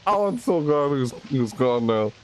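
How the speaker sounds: usual speaking voice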